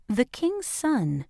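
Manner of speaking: ordinary delivery, nothing marked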